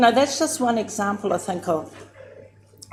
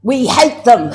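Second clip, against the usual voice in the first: loud retort; 4 semitones higher, 9 dB louder than usual. Retold or imitated. Aunties answer her question.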